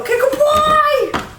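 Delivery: loud, high goodbye; excited goodbye. Real, not acted. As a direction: shout the sign-off cheerfully. Shouted sign-off to viewers.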